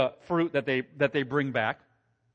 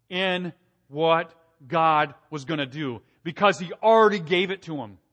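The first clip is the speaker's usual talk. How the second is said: normal talking voice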